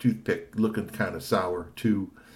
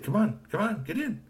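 high hurried urging; high urgent Kashi; 13 semitones higher than usual. Acted. Kashi urging Tony into the car, hurried. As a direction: hurry him into the car.

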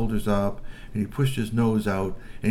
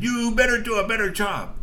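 threatening troll; 12 semitones higher, 3 dB louder than usual. Acted. Nose to nose threat.

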